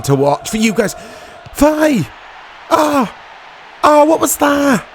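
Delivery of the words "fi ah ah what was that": sudden high shout of surprise; surprised shout. Real, not acted. Gamer yelping at a sudden chance on goal, surprised shout.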